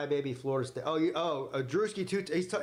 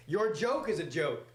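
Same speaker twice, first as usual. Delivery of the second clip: usual speaking voice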